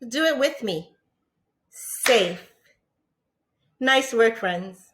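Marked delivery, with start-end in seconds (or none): 1.7-2.5 "Safe" said as one clapped beat; one firm clap on "Safe"; clapped word. Shown as a demonstration. Teacher clapping out "Safe" with the class. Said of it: "Let's clap out the word safe".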